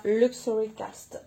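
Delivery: playfully attempted British accent. Shown as a demonstration. Vlogger reading a paper product's name, mock British accent. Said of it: "Un bel accent british".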